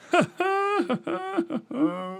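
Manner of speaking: high squeaky giggle; high laugh. Real, not acted. Comic laughing high at their own punchline. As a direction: giggle at your own bad joke.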